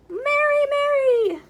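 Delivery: high, loud sing-song "Mary, Mary"; mock the Who children with a high, loud lilt. Grinch mocking the Who children, high sing-song.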